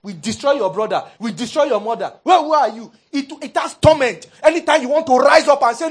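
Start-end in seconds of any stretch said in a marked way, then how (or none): 0.0-2.9 loud, raised-pitch belittling taunt; demoralizing challenge. Acted. Fear confronting someone who tries to resist, loud belittling challenge. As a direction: hurl it as a contemptuous threat. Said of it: "it always wants to demoralize you".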